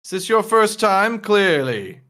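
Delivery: mildly patronize a clueless guest; condescending doorman. Acted.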